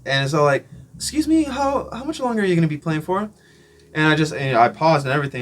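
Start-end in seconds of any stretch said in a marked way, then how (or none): 0.9-3.3 high, polite imitation of a woman; mock-pleasant lady's inquiry, pitched up; nice lady. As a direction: ask sweetly in a raised voice. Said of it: "all nice at first".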